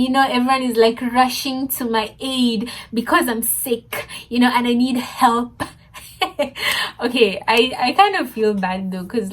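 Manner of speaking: ordinary talk, nothing marked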